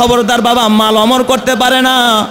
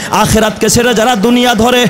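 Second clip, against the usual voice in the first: normal talking voice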